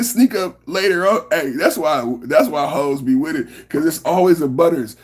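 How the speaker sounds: usual speaking voice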